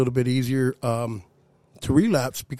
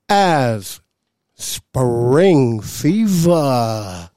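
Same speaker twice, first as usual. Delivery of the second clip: slow drawn-out build to the punchline; dramatic slow reveal; 3 dB louder, much slower than usual. Acted. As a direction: stretch each word for effect.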